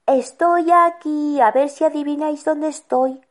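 high-pitched demonstration of a hider's call. Shown as a demonstration. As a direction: call teasingly from a hiding place. Models a call from hiding.

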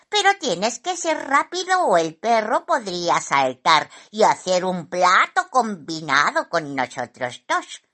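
bird character laying out a plan; a hint of a bossy bird; lightly voiced helpful magpie. A narrator's reading.

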